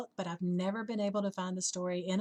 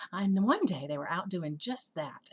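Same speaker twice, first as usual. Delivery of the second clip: ordinary delivery, nothing marked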